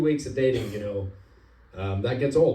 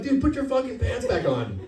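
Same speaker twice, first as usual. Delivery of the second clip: exasperated cop; 13 semitones higher than usual. Retold or imitated.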